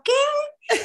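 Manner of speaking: very high-pitched, mock-shocked squeal; incredulous reaction. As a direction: act out total disbelief, pitch shooting up. Retells her reaction to lookalike claim.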